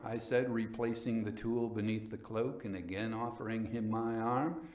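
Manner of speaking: plain reading voice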